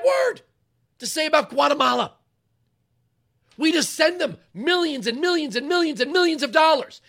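loud, heated rant, pitch way up; fired-up radio yelling. Real, not acted. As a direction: shout it at the listeners. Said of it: "[name], you're yelling".

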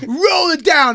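high, loud barked command; angry cop order. Retold or imitated. Cop at [name]'s car window, high angry order. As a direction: demand it through the window. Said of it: "And he goes".

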